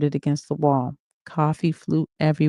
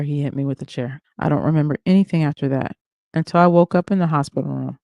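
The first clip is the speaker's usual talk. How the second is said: ordinary delivery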